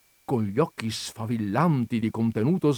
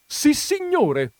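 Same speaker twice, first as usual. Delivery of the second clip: high, loud, stretched yes; proud exclamation; 6 dB louder, slower than usual. A narrator's reading. Countess confirming to the visitor, proud exclamation. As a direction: exclaim it with haughty force. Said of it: "esclamò la contessa".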